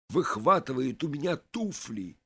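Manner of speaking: ordinary delivery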